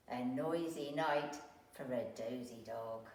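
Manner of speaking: ordinary delivery, nothing marked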